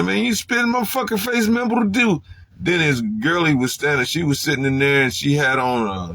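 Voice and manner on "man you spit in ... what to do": pitched-up mock rage; angry retold confrontation. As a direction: confront him for spitting in your face. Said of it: "went up in there".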